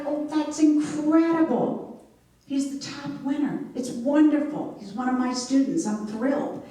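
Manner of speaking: high, gushing delight; thrilled teacher gushing over her student's national award. Retold or imitated. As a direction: bubble over with joy, pitched high.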